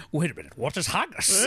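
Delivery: hammed-up Scottish accent, mock shout; a loose Sean Connery Scottish burr. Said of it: "In the best Scottish accent".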